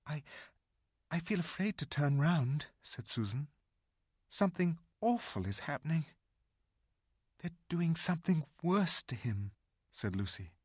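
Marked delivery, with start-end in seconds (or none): 0.1-2.7 a hint of a scared girl, higher and halting; a scared girl stumbling over her words; lightly voiced frightened girl. Susan voiced in the story. 4.3-6.1 a hint of a scared girl, higher and halting; a scared girl stumbling over her words; lightly voiced frightened girl. Susan voiced in the story. 7.4-9.5 higher, a hint of a worried young girl; a young girl fearing the worst; lightly voiced anxious girl. Lucy voiced in the story.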